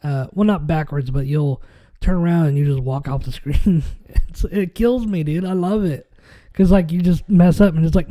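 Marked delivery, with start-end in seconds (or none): none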